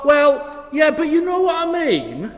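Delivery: high, loud mock-insistent comeback; passive-aggressive. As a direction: imitate someone pushily dodging the question. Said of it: "that sort of passive-aggressive thing".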